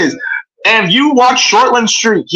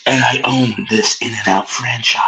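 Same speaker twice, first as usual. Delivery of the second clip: villain voice; 11 semitones lower, 5 dB quieter than usual. Pretend. Continues the low villain voice.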